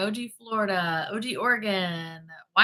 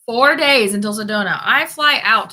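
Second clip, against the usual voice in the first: loud, eager countdown; excited countdown; 3 semitones higher, 9 dB louder than usual. Real, not acted. Host telling viewers about her trip, loud and excited. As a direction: announce it like big news. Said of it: "I'm so excited".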